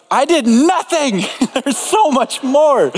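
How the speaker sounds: high, drawn-out comic exasperation; mock despair. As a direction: play the hopeless snow shoveler for laughs. Mock despair shoveling snow.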